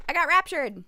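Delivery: mock excited cry. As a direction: call it out with playful excitement. Repeats the line, escalating.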